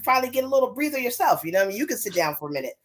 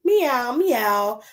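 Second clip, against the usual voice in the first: storybook cat meowing twice; drawn-out, falling meows; cat's meow; 3 semitones higher than usual. Acted.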